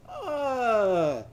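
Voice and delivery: long high "uh" sliding down; drawn-out "uh" cry. Shown as a demonstration. Uncle Upton up in his tree, long falling "uh". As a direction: start high and let the "uh" descend. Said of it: "so go".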